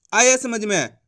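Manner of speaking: ordinary talk, nothing marked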